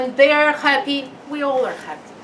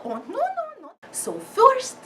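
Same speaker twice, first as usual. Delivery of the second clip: ordinary talk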